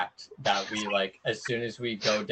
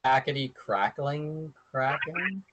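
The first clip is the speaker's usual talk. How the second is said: slow, halting tongue twister; slow attempt; 5 semitones higher, slower than usual. Shown as a demonstration. Player taking game master's dare, slow questioning attempt. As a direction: say "Hackety crackling kraken" slowly and unsurely. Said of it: "Five times fast. Come on".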